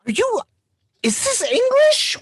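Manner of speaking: high-pitched, halting disbelief; baffled question. Retold or imitated. Parent to babbling toddler, baffled. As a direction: puzzle it out haltingly, voice way up.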